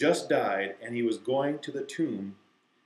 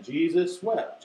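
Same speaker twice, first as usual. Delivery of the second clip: higher, drawn-out recitation; slow, deliberate; 4 semitones higher, slower than usual. Shown as a demonstration. Teacher repeating the verse with kids, slow and deliberate. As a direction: stretch each word for the group. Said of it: "I'll do it slowly".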